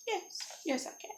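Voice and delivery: soft, high-starting yes; flattered dragon. Acted. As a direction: agree softly, pleased with yourself.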